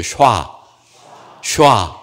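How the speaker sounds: 샤 said alone as one rounded "shwa" syllable; model pronunciation. Shown as a demonstration. Preacher modelling a Hebrew word for the congregation, isolated syllable 샤. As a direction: give 샤 as "shwa" for repeating. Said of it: "한번 해보세요".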